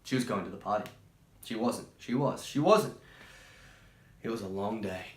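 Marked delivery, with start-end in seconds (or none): 2.4-3.0 emphatic no. A narrator's reading. Narrator flips between yes and no.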